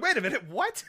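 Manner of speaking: high, startled double take; surprised. Retold or imitated. Waking up to find the strange dream was real. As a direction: snap awake in disbelief. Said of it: "I'm like".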